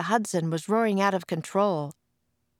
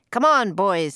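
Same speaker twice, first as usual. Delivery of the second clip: rallying call; 3 semitones higher, 7 dB louder than usual. A narrator's reading. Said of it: "said Mose".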